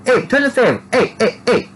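put-on very high, squeaky accent voice; silly falsetto accent, each word dropping at the end; put-on oddball accent. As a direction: squeak it in a goofy unplaceable accent. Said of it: "I don't know what accent that is".

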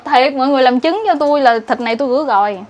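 normal talking voice

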